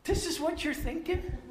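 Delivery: high, disbelieving really; incredulous Jesus. Retold or imitated. Jesus staring at the brothers, incredulous and high. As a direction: raise the pitch in mock disbelief. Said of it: "Jesus probably just looked at him and said".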